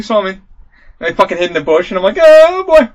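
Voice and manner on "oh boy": high, loud retold exclamation; mock panicked yell. Player retelling hiding in the bush, mock panicked yell. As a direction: yelp it, acting out the dismay. Recounting a panicked game moment.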